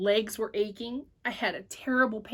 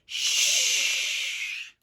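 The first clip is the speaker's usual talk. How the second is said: librarian shush. Acted. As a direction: shush the noisy boy. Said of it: "finger against her lips".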